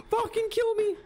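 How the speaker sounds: gasp, then high-pitched outburst; real high, panicked plea; despairing cry. Real, not acted. Scared in dark tunnel.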